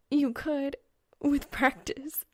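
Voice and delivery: put-on light teasing girl voice; Reaper's voice. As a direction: voice the girl character up high. Female character teasing.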